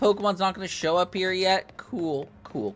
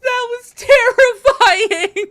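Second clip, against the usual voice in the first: high, slow laughing relief; 14 semitones higher, 3 dB louder, much slower than usual. Real, not acted. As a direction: laugh it out after a scare. Laughing after narrow escape.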